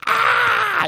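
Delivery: very high, loud cry of pain; shout of pain. Retold or imitated. Preacher showing the cry he couldn't make in meeting, yelling. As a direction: let out the yell he had to hold back. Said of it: "I can't say anything like".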